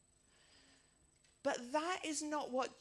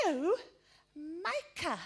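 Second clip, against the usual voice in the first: put-on firm small-child correction; little boy correcting; 7 semitones higher, 5 dB louder, slower than usual. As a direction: insist like a toddler. Toddler corrects old lady.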